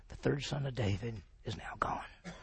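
solemn whispered aside; hushed whisper. Real, not acted. Teacher to the class, a solemn whisper. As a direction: let it fall to a whisper. Teacher grieving over David's loss.

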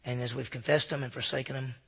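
soft, reverent prayer voice; hushed pastor's prayer; closing prayer. Acted. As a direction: pray gently over the room.

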